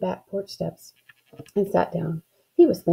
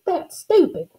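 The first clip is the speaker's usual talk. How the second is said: high, scornful retort; indignant demand; 10 semitones higher than usual. A narrator's reading. Girl scoffing at her brother, indignant retort. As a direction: dismiss it with a high jump in pitch. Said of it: "I demanded to know".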